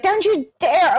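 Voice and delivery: high sharp warning. Retold or imitated. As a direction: say it like a stern warning. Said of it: "And she's like".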